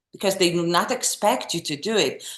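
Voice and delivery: ordinary talk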